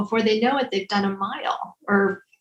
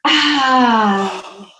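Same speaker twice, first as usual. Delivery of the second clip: audible exhale sigh; 4 dB louder than usual. Acted. Exhale during twist exercise.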